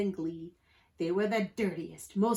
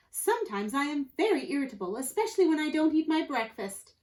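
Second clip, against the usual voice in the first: higher-pitched, prim would-be pirate; proper-spoken rascal; 6 semitones higher, 3 dB louder than usual. A narrator's reading. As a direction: confess it very politely.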